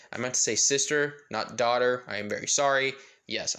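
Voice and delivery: ordinary delivery, nothing marked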